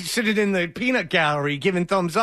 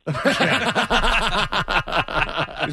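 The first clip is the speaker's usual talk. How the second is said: big laugh; 3 semitones higher than usual. Real, not acted. Host cracking up at the caller's answer, laughing. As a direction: crack up helplessly.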